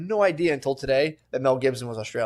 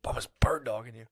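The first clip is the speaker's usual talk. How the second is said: low half-whispered reassurance; quiet, breath-heavy mock explanation; hushed aside; 6 semitones lower, 10 dB quieter than usual. Voicing a quote to someone stared at.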